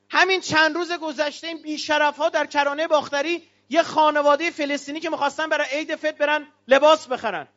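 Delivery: normal talking voice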